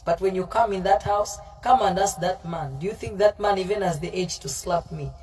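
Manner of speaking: normal talking voice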